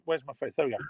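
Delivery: ordinary delivery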